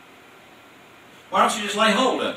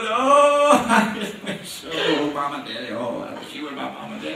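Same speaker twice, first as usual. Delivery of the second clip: put-on whining child; 6 semitones lower than usual. Child pleading with parents, hammed-up whine. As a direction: beg like a sulky kid. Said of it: "And they go".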